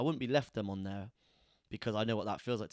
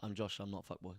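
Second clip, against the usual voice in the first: deadpan denial, trailing off softer; straight face; 3 dB quieter, faster than usual. Shown as a demonstration. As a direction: state it soberly, getting quieter. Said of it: "Say it with a straight face".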